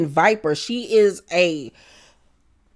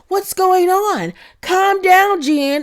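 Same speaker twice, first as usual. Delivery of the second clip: higher mock-flustered Heather; imitating Heather; 11 semitones higher, 3 dB louder, slower than usual. Heather trying to calm Jen, higher and flustered. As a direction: squeak out a flustered plea.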